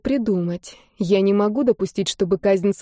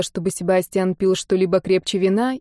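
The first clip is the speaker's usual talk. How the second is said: plain reading voice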